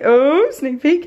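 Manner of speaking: slow, drawn-out playful tease; sing-song. Acted. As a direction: stretch it out teasingly. Repeats it playfully.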